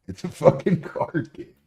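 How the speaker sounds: loud high mock outrage; loud high disbelieving cry; incredulous outburst. Real, not acted. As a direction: throw up your hands at it. Mocking outrage punchline.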